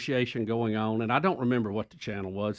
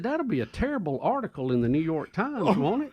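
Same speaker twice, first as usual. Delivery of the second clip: mom voice; 7 semitones higher than usual. Retold or imitated. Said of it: "She said".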